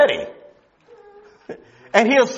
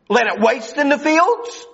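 ordinary talk, nothing marked

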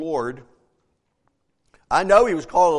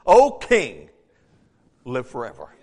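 with honor; 8 semitones lower, 4 dB louder than usual. Retold or imitated. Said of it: "He said with honor".

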